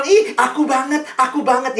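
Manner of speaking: high, loud squeal of recognition, mimicked; excited teen. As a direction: mimic a teen thrilled by their horoscope. Teen recognizing self in horoscope. (remaja heboh yang ditirukan)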